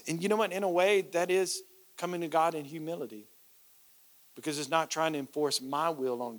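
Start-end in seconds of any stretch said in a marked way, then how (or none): none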